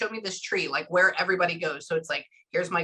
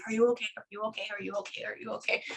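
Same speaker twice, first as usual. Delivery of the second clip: soft, repeated fussing; light, quiet busy-bee fretting; imitated worrying; 8 dB quieter, much faster than usual. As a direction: keep checking in an undertone. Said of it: "that's the whole worrying thing".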